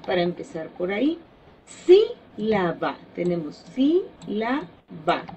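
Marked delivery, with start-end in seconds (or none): 1.7-3.0 Sílaba slowly, SÍ... la... ba; syllable by syllable. Shown as a demonstration. Teacher splits example word into syllables. 3.7-5.3 sílaba slowly in three beats, SÍ... la... ba; syllable by syllable. Shown as a demonstration. Teacher splits example word into syllables.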